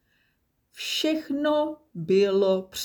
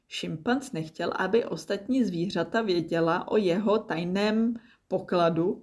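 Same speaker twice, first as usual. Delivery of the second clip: usual speaking voice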